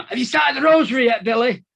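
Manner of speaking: high, loud call across the landing; shouting across landings. Retold or imitated. Inmate calling across the landing to a mate, loud shout.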